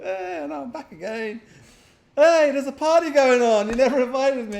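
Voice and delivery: pushy Crow. Retold or imitated. Streamer mimicking Crow demanding to join, loud and high. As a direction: whine that you weren't invited. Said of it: "And he's like".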